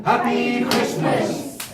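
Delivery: loud, drawn-out chant of the magic words; calling out magic words. Acted. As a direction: sing it out slowly to the crowd. Leads audience in magic words.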